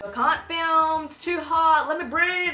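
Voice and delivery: whiny camera voice. Pretend. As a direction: whine like an overheated camera. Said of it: "and it's like".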